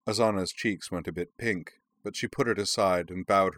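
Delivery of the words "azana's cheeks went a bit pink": usual speaking voice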